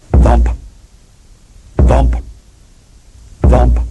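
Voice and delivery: booming thump sounds, pitch jumping; loud thumps. A narrator's reading. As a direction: punch each thump loudly. Sound effect narrated.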